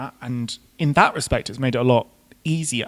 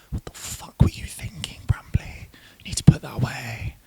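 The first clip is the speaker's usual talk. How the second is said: hushed, whispered self-scolding; appalled whisper; 7 dB quieter than usual. Retold or imitated. Whispering to themself at the sight of an ugly wig, appalled. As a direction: whisper it to yourself in disbelief.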